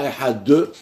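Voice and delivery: "Ehad deux": a vowel added after the D, ehad-euh; overdone final D. Shown as a demonstration. Teacher warning against overdoing it, "Ehad" with added final syllable. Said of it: "ne pas rajouter trop".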